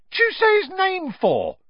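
high-pitched alarmed squawk; yelp. A narrator's reading. Stan scolding Harry for saying the name, yelping. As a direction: yelp the question, very high and loud. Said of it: "yelped Stan".

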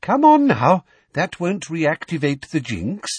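loud sweet coaxing. A narrator's reading. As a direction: wheedle her gently.

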